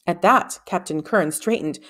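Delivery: plain reading voice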